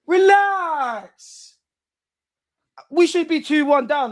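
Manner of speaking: high, loud pleading yell at the team; frantic shouting. Real, not acted. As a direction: beg them to relax at the top of your voice. Fan pleading with team mid-match.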